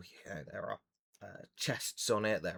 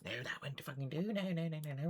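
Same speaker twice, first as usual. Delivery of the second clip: high, softer muttered refusals; fussing gnome; 6 semitones higher, 5 dB quieter than usual. Acted. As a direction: mutter to yourself while rummaging.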